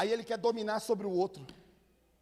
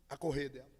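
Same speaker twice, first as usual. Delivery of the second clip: usual speaking voice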